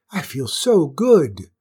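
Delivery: loud, slow delight; blissful, emphatic exclamation; elated. A narrator's reading. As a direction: stretch it out with joy. Queen elated by the drink.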